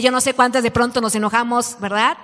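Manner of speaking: normal talking voice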